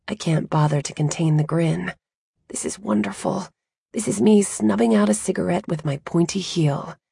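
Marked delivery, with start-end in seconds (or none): none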